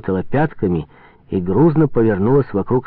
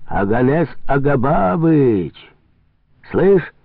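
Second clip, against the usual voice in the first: higher, drawn-out hail; calling out; 6 semitones higher, slower than usual. Acted. Farkov hailing the trader on the boat, long call. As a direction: stretch the name out across a distance.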